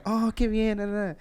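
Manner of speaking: high, light Spanish chatter. Shown as a demonstration. Man demonstrating his Spanish-speaking voice, high-pitched. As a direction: lift it way up into an uppity lilt. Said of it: "It sounds higher pitch".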